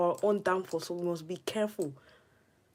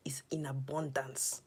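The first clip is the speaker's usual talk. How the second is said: whisper; 4 semitones lower, 9 dB quieter than usual. Real, not acted. Whispered game aside.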